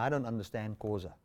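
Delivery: ordinary talk, nothing marked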